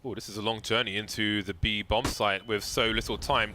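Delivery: usual speaking voice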